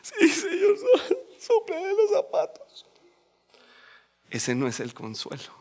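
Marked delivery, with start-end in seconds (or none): 0.0-2.8 hammed-up sob, much higher; fake-weep through a pretend prayer; mock crying prayer. Preacher mocking empty comfort with a sobbing prayer. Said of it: "Y yo".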